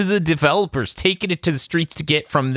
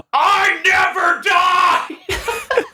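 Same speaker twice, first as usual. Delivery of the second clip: drawn-out, high-pitched battle scream; imitated scream; 3 dB louder, much slower than usual. Host imitates downed game character, hammed-up scream. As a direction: scream it long and high, hamming it up. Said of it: "he just screams".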